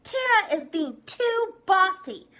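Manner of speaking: loud, high telling-on voice; tattling complaint. Acted. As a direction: complain to the teacher. Girl complains to teacher.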